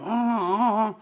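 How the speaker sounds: high put-on cackle; mock laugh. Bar owner mocking Kim Jong-un, silly cackle.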